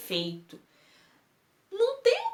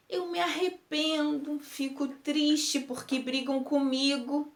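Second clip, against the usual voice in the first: usual speaking voice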